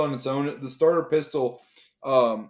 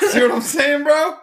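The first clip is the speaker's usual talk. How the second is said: high, loud eager pitch; excited planning; 13 semitones higher, 9 dB louder than usual. Real, not acted. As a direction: sell the plan with excitement. Pitching the shared plan, thrilled.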